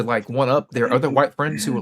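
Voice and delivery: usual speaking voice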